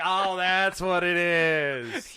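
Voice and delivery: very slow, knowing "oh that's what"; drawn-out realization. Retold or imitated. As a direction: draw out the realization. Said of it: "You're like".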